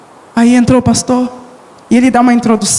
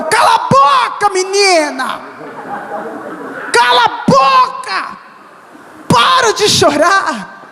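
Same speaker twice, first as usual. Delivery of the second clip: mock scolding shout; 7 semitones higher, slower than usual.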